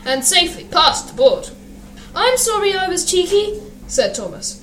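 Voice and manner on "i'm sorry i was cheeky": high little-engine voice, apologetic; sheepish apology. A narrator's reading. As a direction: say sorry meekly, pitched well up. Thomas apologises to Gordon.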